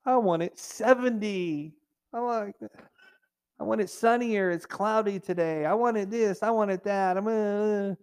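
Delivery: petulant mock whine; mock complainer. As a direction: play the spoiled grumbler, whining. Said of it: "But we could complain".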